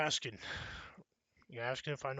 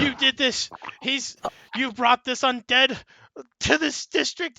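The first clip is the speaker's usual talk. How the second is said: high, loud, slow panicked shout; frantic accusation; 11 semitones higher, 13 dB louder than usual. Acted. Scared priest screaming blame at Kenji.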